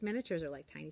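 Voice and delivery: usual speaking voice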